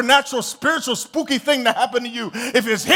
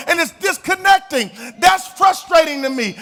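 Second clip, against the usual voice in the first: normal talking voice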